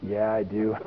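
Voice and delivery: low, soft reply; quiet admission. Retold or imitated. Preacher answering the Father deep in his spirit, quietly and low. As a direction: answer softly, pitched well down.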